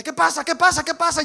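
high, loud panicked questions; alarmed wife. Retold or imitated. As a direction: voice the wife startled awake. Wife woken by screaming. (la esposa alarmada, aguda y fuerte)

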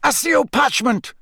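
called-out spell. A narrator's reading. Moody summoning the map by spell, called out high. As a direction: fire off the incantation sharply upward.

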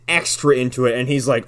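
ordinary delivery

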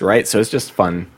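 usual speaking voice

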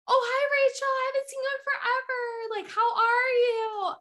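fake-sweet greeting. Mocking the fake woman greeting Rachel, high sugary gush. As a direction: mimic a phony overly cheerful hello. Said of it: "acting like everything's fine".

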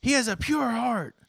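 high, slow mock-sincere protest; Denji, earnest. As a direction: swear it, slow and high, like a boy pleading. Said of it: "but I'll be Denji".

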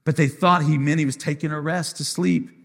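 ordinary talk, nothing marked

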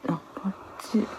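whispered to herself. Real, not acted. Checking the search results.

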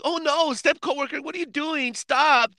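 high, fake-shocked voice teasing a friend; mimicking the friend's scandalized high squeal; mock flustered protest. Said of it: "And you're like".

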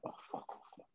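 whispered curse under the breath. Real, not acted. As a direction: hiss it out in a whisper. Reacting to Boris's quote.

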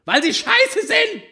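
put-on high teen girl voice, yelling; teenage tantrum. A narrator's reading. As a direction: shout like a sulky teenager.

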